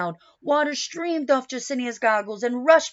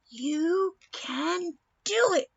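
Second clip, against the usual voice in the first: slow, high cheer; mother urging daughter on, high cheer; encouraging cheer; 6 semitones higher, slower than usual. Acted. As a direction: cheer her on with all your heart. Mother cheering in her mind.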